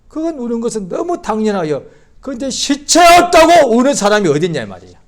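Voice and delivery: loud, high preaching, slowing into a shout; rising fervour, driving the point home; full-force sermon climb. Acted.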